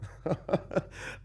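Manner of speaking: breathy staccato chuckle; knowing laugh. Acted. Narrator answers companion's question, knowing laugh. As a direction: laugh it off without voice.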